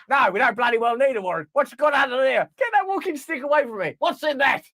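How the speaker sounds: high, blustering cop; gruff cop. Acted. Police officer skit.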